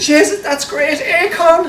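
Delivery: high, slow Irish accent put on. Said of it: "that's the Irish accent".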